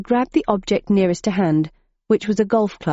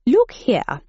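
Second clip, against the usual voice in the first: posh gentleman; 5 semitones higher than usual. A narrator's reading. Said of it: "replied the character".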